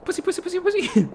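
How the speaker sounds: high, sing-song mocking call; mimicked cat call. Player copying game character calling cat, mock high call.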